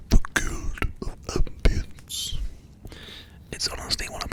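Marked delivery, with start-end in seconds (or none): 0.1-2.4 "The Guild of Ambience" slow and grand, like a title; dramatic announcer whisper. Acted. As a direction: stretch out "The Guild of Ambience" mysteriously.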